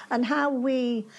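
ordinary delivery

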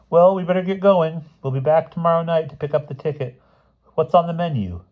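high schoolgirl voice; breezy girl, high and lively; chirpy schoolgirl. A narrator's reading. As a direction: play a girl pitched well above your own voice.

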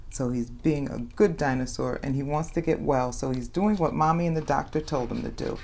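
ordinary delivery

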